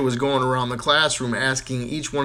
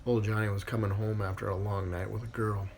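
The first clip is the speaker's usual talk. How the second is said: normal talking voice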